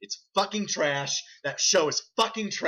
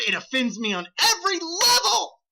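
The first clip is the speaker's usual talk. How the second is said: high, emphatic outrage; worked-up comic indignation; indignant rant; 12 semitones higher, 4 dB louder than usual. Real, not acted. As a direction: climb high on an offended protest. Ranting about a hated show.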